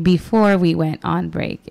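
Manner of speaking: slow, deliberate restatement. Real, not acted. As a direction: take it slowly, word by word. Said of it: "I know I can talk a little fast".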